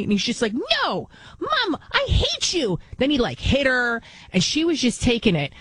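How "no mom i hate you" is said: high-pitched mock child tantrum, drawn out at the end; imitated whiny brat. Whiny boy talking back to his mom, mock tantrum. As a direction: whine it like a spoiled little kid.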